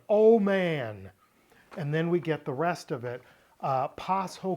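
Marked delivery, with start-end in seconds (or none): 0.1-1.1 "Oh man" higher and louder, pleading call; emphatic cry of "Oh man"; stressed. Shown as a demonstration. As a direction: lean hard into "Oh man". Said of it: "He's really stressing".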